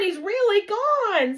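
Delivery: put-on high, loud insistent boy; excited exclaim. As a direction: insist loudly to mom. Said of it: "Simon exclaimed".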